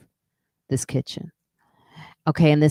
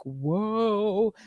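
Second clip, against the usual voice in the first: impressed whoa; 5 semitones higher, 4 dB quieter than usual. Retold or imitated. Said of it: "my dad would be like".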